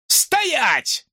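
high, sharp order; shouted freeze; police command shout. A narrator's reading. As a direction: bark the command. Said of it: "крикнул он".

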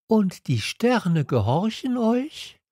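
put-on a boy testing the king's claim; little prince asking the king in wonder; curious little prince. A narrator's reading. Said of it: "prince asks the king".

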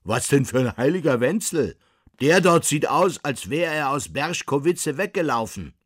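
loud, high-pitched scoffing; indignant, mocking retort; derisive coachman. A narrator's reading. Coachman mocks the painted saint.

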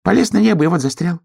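quick, high squirrel voice; high-pitched tattling squirrel; squirrel shouting. A narrator's reading. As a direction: report it from above in a fast, high call. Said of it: "крикнула со звезды белка".

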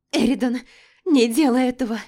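high, loud desperate scream; screaming plea. Acted. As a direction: scream to stop him. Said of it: "закричала я".

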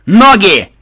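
loud, high shout to run; high-pitched urgent yell; urgent command. Acted. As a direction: bark one sharp order.

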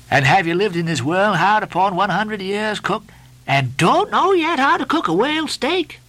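high, incredulous questioning; Stubb, scolding mate. A narrator's reading. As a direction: berate the cook, voice climbing high.

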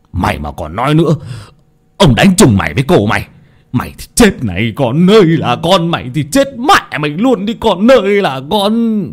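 furious master threatening a servant, rising to a wail; enraged hissing threat. A narrator's reading. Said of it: "nhìn con hầu mà rít lên". (giọng rít lên giận dữ, đe dọa)

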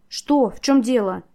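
high, loud startled questioning; alarmed question. A narrator's reading. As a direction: ask in sudden alarm. Alarmed heroine sees him laughing.

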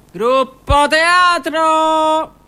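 high, drawn-out loud call. Acted. Summoning the theatre group prisoners, long loud call. Calling the inmates down.

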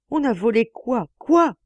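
high, loud yell rising to a shriek on Quoi. Acted. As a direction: scream the last word. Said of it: "monsieur continuait de hurler". (monsieur hurle, furieux)

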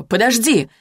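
mild narrated call, pitch lifted; calling after her. A narrator's reading. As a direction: call out lightly to stop someone leaving. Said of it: "крикнул он мне вслед".

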